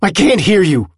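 high, loud, angry complaint; shouting. A narrator's reading. Willem can't hear his friend, shouting. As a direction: shout it straight at him. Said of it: "he shouts at him".

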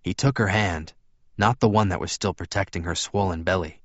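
usual speaking voice

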